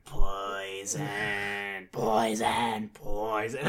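low, drawn-out creature chant; rock minions. Retold or imitated. Imitating rocks retreating into the cliff, low creature chant. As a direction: intone it low like living rocks.

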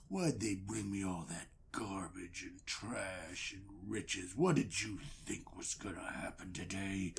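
low, slow growl; deep villain taunt; menacing wrestler. Acted. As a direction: loom over him with a deep threat.

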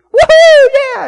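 very loud, very high whooping cheer; big debtor's ecstatic cheer, very loud; wild cheer. Pretend. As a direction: whoop it up like a freed man. Debtor celebrating huge forgiven debt.

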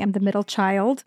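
usual speaking voice

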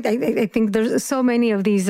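ordinary delivery

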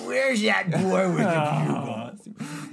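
slow, high showbiz-diva caricature; Carol Channing. Shown as a demonstration. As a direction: drag out every word as a stage diva. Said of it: "let me do it as Carol Channing".

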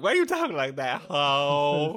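hammed-up confrontational sass; sassy inner monologue. Echoing a friend's unspoken comeback, played sassy. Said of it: "That's the inner monologue".

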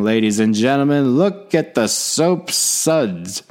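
high, slow, booming sportscaster drama over dishes; Howard Cosell impersonation. Retold or imitated. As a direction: call the dishwasher like a legendary broadcaster. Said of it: "I could do a Howard Cosell impersonation".

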